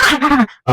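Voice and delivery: high imitated cries; mock scream. Security guard hit by melting pies, mock high screams. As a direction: wail in cartoon alarm, very high. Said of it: "And it's like".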